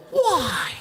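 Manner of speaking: high, sliding whine; puzzled question. Acted. Driver pushing his luck with the cop, high-pitched. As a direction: start way up high and slide down.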